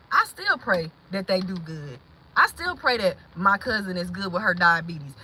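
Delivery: low, soft and sincere; real heartfelt prayer. Real, not acted. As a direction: say it gently, meaning it. Praying for hurtful family.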